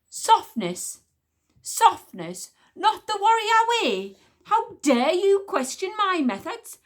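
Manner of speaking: loud, high outraged queen; icy fury. Acted. Queen throws his insult back at the witch smeller, loud and furious. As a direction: spit the words back at him. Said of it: "in a voice that could have frozen".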